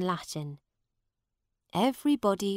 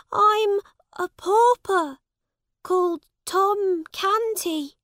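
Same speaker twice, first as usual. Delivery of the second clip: put-on much higher, louder boy's voice, desperate; Tom pleading; 12 semitones higher, 7 dB louder than usual. A narrator's reading. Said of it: "cried Tom on his knees".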